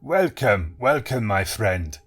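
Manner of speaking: put-on Eastern European accent. A narrator's reading. Hypnotist greeting Benson, Eastern European accent. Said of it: "a strong accent which Benson judged to be Eastern European".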